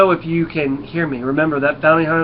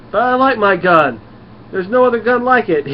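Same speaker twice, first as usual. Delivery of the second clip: high, loud mock whine of objection; comic protest; 8 semitones higher, 6 dB louder than usual. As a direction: plead loudly for your beloved gun, hammed up. Voicing Venom protesting about his gun.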